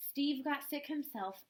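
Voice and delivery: ordinary delivery, nothing marked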